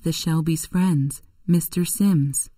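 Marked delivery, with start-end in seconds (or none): none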